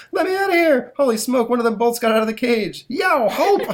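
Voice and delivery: high, loud panicky yelling; panicked yelp. Acted. Man hit by the bolt.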